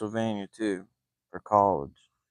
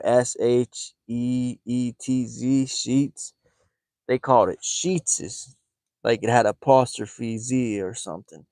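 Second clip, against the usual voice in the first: normal talking voice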